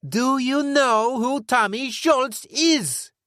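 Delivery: very high, loud, drawn-out yell; furious shout. Acted. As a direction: scream it at the top of your lungs. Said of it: "yelled at the top of his lungs".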